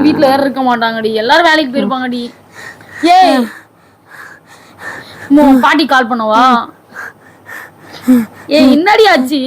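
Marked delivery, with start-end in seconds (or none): 3.2-9.4 frantic worry for her friend; real alarm; panicked questions. Real, not acted. Panicked asking what happened.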